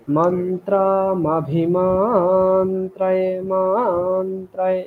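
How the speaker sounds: "মন্ত্রায়ে" chanted slowly with the first vowel drawn out; extra-long vowel. Shown as a demonstration. Teacher showing the extra vowel, slow chant of "মন্ত্রায়ে" with lengthened ম. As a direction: stretch the vowel after ম in "মন্ত্রায়ে". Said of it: "মকারে extra স্বর বলতে হবে".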